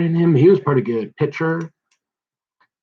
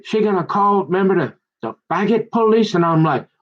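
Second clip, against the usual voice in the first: ordinary talk, nothing marked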